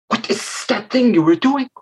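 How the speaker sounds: high-pitched hissing question; disapproving mother snake. Acted. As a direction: question the child with snaky sibilants. Mother snake questions her child.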